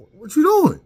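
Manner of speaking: alarmed shout at the bags; startled shout. Retold or imitated. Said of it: "I'm like".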